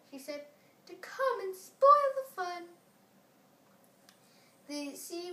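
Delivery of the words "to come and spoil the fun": high, loud sulky complaint; the moon pouting about the sun; a peevish little moon, raised high. A narrator's reading. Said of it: "shining sulkily".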